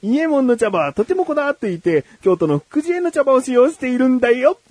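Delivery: high, sing-song teacher voice; lesson voice. Pretend. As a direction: explain it like a kids' lesson. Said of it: "レッスンワンいきます。".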